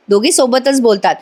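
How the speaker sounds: plain reading voice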